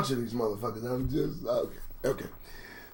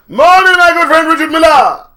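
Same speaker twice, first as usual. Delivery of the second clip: loud, much higher hearty call-out; loud greeting; 12 semitones higher, 14 dB louder than usual. Real, not acted. Greeting chat viewer by name.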